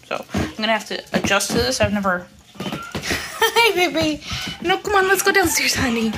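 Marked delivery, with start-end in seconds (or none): 2.6-6.1 high-pitched coo with a laugh; pet coaxing. Real, not acted. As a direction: coax the dog along in a high sweet voice. Coaxing dogs on stairs.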